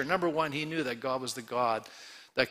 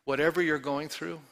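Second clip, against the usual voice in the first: ordinary talk, nothing marked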